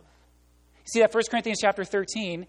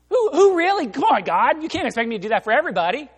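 whiny objection; 7 semitones higher, 7 dB louder, faster than usual. Retold or imitated. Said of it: "We say".

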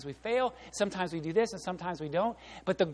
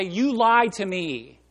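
indignant complaint; 4 semitones higher, 8 dB louder than usual. Retold or imitated. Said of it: "call them up and say".

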